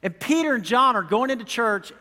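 ordinary talk, nothing marked

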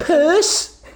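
high, loud mock Scouse exclamation; hammed-up Scouse, pitched right up; Liverpool accent. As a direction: put on an exaggerated Liverpool accent and yelp it. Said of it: "do your best Liverpool Liverpool accent".

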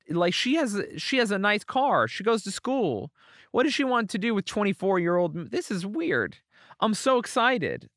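high-pitched, giddy retold disbelief; excited bafflement. Comedian replaying his younger self dazzled by a woman, excited bafflement.

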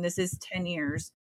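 normal talking voice

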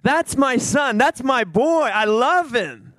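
high, stretched-out boasting; acting out God's delighted pride; excited proud father. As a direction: boast about your boy, savoring each phrase. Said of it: "he's just so excited".

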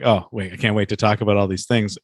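usual speaking voice

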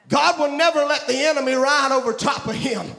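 full-force preaching. Acted. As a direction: preach it out to the congregation.

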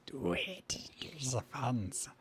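slow, hushed whisper; urging whisper. Acted. Urging the party to spend, whispered. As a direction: whisper it like a tempting voice.

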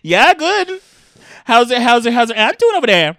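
loud, high cartoon northern small talk; Minnesota-Canadian accent. Asking after a neighbour's aunt, Minnesota-Canadian accent.

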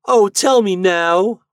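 high, loud, drawn-out plea; storybook pleading cry; eager cry. A narrator's reading. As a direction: beg loudly like an impatient small pumpkin. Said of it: "cried the little pumpkin".

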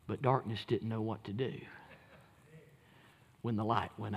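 low, soft and full of pauses; quiet, low-pitched suspense from the pulpit; hushed preaching. Acted. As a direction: drop right down to a near hush, letting each phrase hang. Preacher drops to a hush.

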